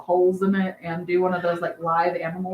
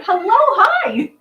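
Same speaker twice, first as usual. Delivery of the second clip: much higher, louder, slow drawn-out hello with a laugh; squealy greeting; 11 semitones higher, 7 dB louder, slower than usual. Real, not acted. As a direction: gush a greeting, way up high. Greets viewer's fiance excitedly.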